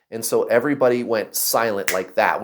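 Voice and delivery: ordinary delivery, nothing marked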